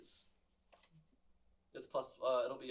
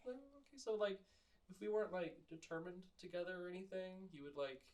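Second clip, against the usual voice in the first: hurt, quiet plea; 3 semitones higher, 12 dB quieter than usual. Acted. Hurt sloth kid pleading.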